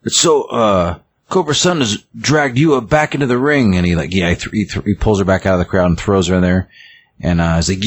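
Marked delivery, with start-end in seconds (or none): 0.0-3.8 higher-pitched excited play-by-play, retold; wrestling announcer. Announcer calls a wrestler's return to the ring, mock excited. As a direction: imitate an announcer calling the big moment. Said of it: "the announcer's like".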